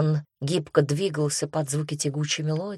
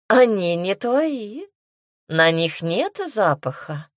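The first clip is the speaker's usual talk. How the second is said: slow taunt, pitch sliding up; smirking drawl; 3 semitones higher, slower than usual. A narrator's reading. Demoness disputing the stranger's claim to the men, smirking drawl. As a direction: draw it out with a smirk. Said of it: "протянула демоница и усмехнулась".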